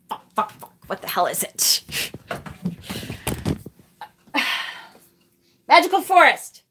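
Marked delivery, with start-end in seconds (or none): 0.1-2.1 hissed whispered swearing, barely voiced; whispered curse. Real, not acted. Squinting at a deck she can't read, whispered swearing. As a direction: curse under your breath in frustration.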